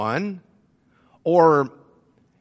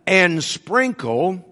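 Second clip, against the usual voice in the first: sprinkle said slowly and deliberately; stress on sprinkle; slower than usual. Shown as a demonstration. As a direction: draw out sprinkle to make it stand out. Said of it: "I emphasize the word sprinkle".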